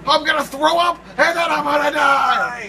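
loud, high, slowed panic; panicked shout. Real, not acted. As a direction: yell like the ride is making you sick. Dizzy panic in game.

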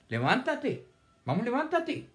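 high-pitched, lively rousing call; urging command. Acted. Teacher telling someone to get up, urging command. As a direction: urge them briskly to get up.

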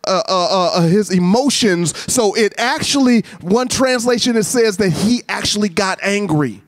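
high, driving sermon delivery; impassioned preaching. Real, not acted. Preacher explaining the leper story to the congregation, impassioned. Preacher at raised pitch and force.

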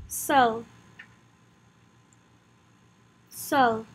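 French "Seul" with a rounded vowel before l; "Seul" modelled in French for learners; French pronunciation drill. Shown as a demonstration. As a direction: say "Seul" as one falling French syllable. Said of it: "Practice saying it with me".

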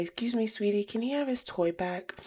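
high, sugary mom voice; overly sweet politeness. Retold or imitated. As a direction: put on a sickly-sweet polite tone to the kid. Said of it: "So I chime in and say".

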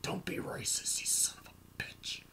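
whispered, limp reprimand; a feeble hushed telling-off; weak scolding. Retold or imitated. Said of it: "he'll come to violence and be like".

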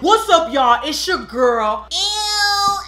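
loud, high [name] impression. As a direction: shout it like [name]. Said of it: "That was a good impression".

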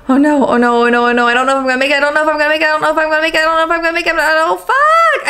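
panicked rush. Real, not acted. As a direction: gabble it out as time runs out. Panicking as game nearly lost.

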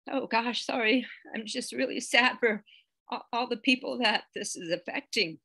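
getting choked up, apologetic; real, emotional sadness. Real, not acted. Teacher apologizing to class for her sadness, emotional. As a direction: hold back tears while talking on. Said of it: "sorry, got emotional".

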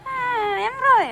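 high whiny mock cry; fake-whimper like the baby. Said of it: "ખોટું ખોટું કાનો કેમ રોવે?".

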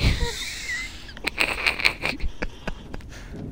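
long, very high scream of fright; drawn-out squeal of panic; panicked shriek. Real, not acted. Spotted by enemy, panics.

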